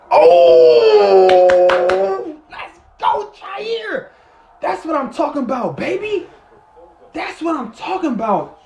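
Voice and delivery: high, loud celebrating yell; excited shout. Real, not acted. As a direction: cheer the big play. Big defensive play celebrated.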